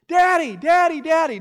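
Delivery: put-on high, loud whiny child calling daddy; toddler calling. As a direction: whine like a little boy reaching for his dad. Son voiced calling for dad.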